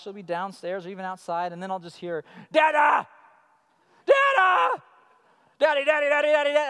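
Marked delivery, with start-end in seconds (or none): 2.5-6.7 put-on much higher, loud child calling Dada. Young daughter shouting for dad from downstairs, high and loud. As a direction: yell for daddy from across the house.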